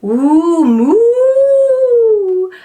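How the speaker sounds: long loud high moo; cow moo. Acted. Voicing a cow for a child, long loud moo.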